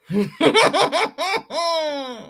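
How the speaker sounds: high, rippling laugh; real laugh, pitched well up. Real, not acted. Streamer amused by her own butt-lifting joke, laughing.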